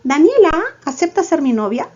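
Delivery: "Daniela" pitched high, already asking; exaggerated questioning lift on "Daniela", no pause after it. Shown as a demonstration. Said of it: "si fuese antes de Daniela".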